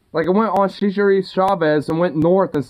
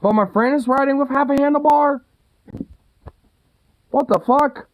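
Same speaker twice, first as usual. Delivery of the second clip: incredulous; 7 semitones higher than usual. Real, not acted. Friend's handlebar half missing.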